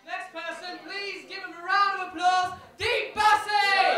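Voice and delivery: booming drawn-out announcer call; announcer intro. Real, not acted. Compere calling the comic to the stage with a booming shout. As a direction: hype up the crowd for the next act.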